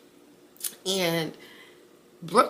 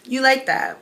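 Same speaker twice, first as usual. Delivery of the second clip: higher, louder teasing echo; flirty tease; 7 semitones higher, 6 dB louder than usual. Retold or imitated. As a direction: toss it back playfully.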